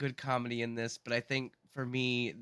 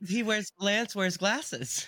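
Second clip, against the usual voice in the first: higher, slow disbelief; incredulous; 9 semitones higher, 4 dB louder, slower than usual. Real, not acted. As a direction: spell out the absurdity slowly. Mocking obvious disguise.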